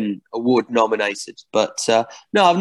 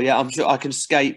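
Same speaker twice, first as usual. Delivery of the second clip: usual speaking voice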